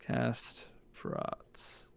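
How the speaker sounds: soft whispered repeat. Real, not acted. Player echoing the spell command, whispered. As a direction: breathe the command to yourself.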